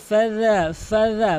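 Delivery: tongue tip at front teeth for "ফাজা", drawn out; correct dhal. Shown as a demonstration. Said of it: "দুই দাঁতের আগার সঙ্গে লাগিয়ে".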